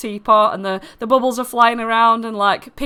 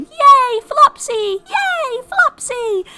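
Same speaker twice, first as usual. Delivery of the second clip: very high squeaky cheer; tiny voices cheering as they float past; little cheering lights; 4 dB louder, much slower than usual. Acted. Dancing lights flowing past Flopsy, high-pitched cheering. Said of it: "they're all going".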